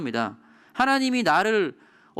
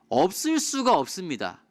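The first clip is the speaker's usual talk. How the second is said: normal talking voice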